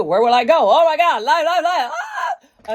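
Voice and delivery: frantic rising freak-out ending in a yelp; mock panic, building to a laughing shriek; retelling her laptop panic, frantic and laughing; panic rant. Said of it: "So I was like".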